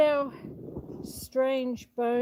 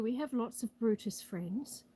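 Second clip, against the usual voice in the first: ordinary talk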